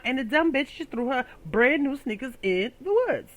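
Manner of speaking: ordinary talk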